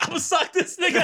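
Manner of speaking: laughing. Retold or imitated. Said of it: "So I'm like".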